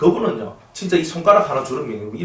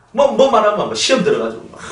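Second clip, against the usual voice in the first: mocking; 8 semitones higher, 5 dB louder than usual. Mocking touchy believers.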